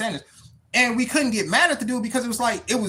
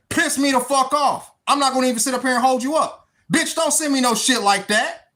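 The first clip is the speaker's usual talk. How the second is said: heated, higher-pitched rant; angry outburst; 6 semitones higher than usual. Real, not acted. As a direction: tell her off, fed up. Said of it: "I'm crashing out, y'all".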